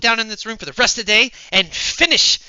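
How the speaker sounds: high, loud, eager announcement; excited. Acted. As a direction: burst out with determined eagerness. Character eagerly announces plan.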